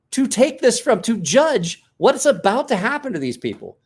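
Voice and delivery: mock exasperated scolding; incredulous retort. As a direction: scoff at his naive claim. Retelling exasperated rant at kid.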